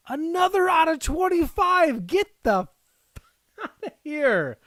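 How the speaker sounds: high, loud disbelieving cry; can't believe the luck, laughing; high laughing exclamation; thrilled outburst. Real, not acted. Another rare numbered card.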